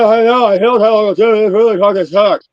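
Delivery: loud, high mock swollen-tongue speech. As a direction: talk like your tongue is too big for your mouth. Acting his swollen-tongue voice.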